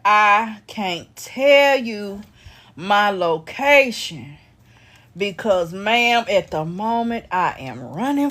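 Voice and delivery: slow, drawn-out phrasing; slowly drawn-out quote; slow retold quote. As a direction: stretch each word out slowly. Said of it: "Baby, he said".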